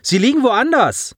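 high-pitched statement, falling at the end; exasperated admission. Acted. Boy reporting misplaced cells, exasperated statement. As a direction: blurt out the bad news.

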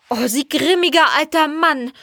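high-pitched angry outburst; indignant flare-up. A narrator's reading. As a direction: flare up at the old man. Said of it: "fuhr Jelena auf".

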